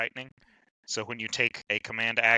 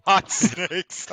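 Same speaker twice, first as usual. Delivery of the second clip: laughing; 7 semitones higher, 7 dB louder than usual. Real, not acted. Laughing at the silly name.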